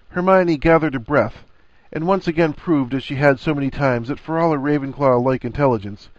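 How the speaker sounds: ordinary delivery, nothing marked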